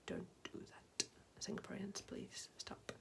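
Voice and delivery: hushed mock-pleading whisper; very soft, whispered appeal; whispered plea. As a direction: whisper it like a pained aside. Mock plea aside after cold beans.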